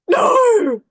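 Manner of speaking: high, loud despairing "No!"; shocked cry. Retold or imitated. At the check-in desk, hearing they can't fly, a loud shocked cry. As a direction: cry out as the plan collapses.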